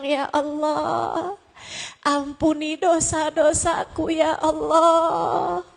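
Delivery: tearful prayer. Acted. Worshipper begging Allah for forgiveness and provision, tearful. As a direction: plead tearfully, stretching each call to Allah. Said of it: "dengan linangan air mata kamu bilang".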